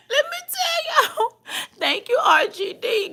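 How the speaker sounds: high, wobbly, tearful laughter; fighting through giggles; laugh-crying. Real, not acted. Thanking a viewer mid-giggle, tearful and high.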